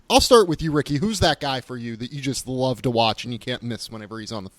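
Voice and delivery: ordinary talk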